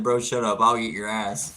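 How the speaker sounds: retold chat line, said loud and amused; hammed-up loud reading of a rude comment; loud mock quote. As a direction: boom out the viewer's line like a joke. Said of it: "just jumped in and said".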